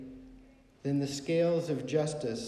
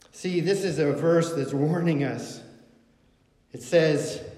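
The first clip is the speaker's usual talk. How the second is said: louder preaching, slowing at the end; preacher warning of judgment; stern warning; 4 dB louder than usual. Acted. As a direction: hammer home the warning. Preacher warns congregation firmly.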